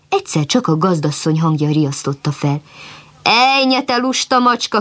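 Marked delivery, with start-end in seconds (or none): 3.2-4.8 mild mock scolding cry; scolding shout. A narrator's reading. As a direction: call out to scold the lazy cat. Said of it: "kiáltott rá a gazdasszony".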